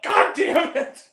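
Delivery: loud, high, drawn-out mock outrage; slow exaggerated yell of exasperation; retold exasperated yell. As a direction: cry out in comic frustration. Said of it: "I'm so mad".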